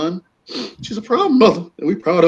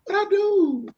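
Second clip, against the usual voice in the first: very high squeaky protest; mimicking a clueless defendant; mock innocence; slower than usual.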